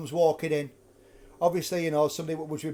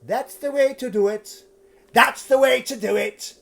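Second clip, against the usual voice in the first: ghostly shout; 3 semitones higher, 3 dB louder than usual. Retold or imitated. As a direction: call it out like a haunting. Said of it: "shouting the words".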